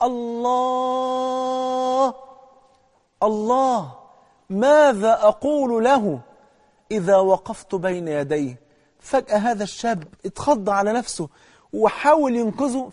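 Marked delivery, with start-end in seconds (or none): 0.0-8.7 slow, faint, distant cry; voice from far away. Acted. Dying man answers from afar, distant slow cry. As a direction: call it out as if from far off. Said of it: "بصوت بقى جاي من بعيد".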